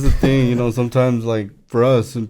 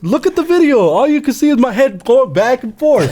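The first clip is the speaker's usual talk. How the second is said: high, fast, laughing protest; mock whiny complaint; 13 semitones higher than usual. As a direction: whine it up high like a beaten kid brother. Mocking own past complaint.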